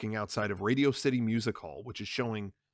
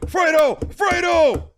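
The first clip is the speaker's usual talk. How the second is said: high loud shout; 12 dB louder, slower than usual. Acted. The Don yelling for Fredo as gunmen run up. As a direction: call out in alarm.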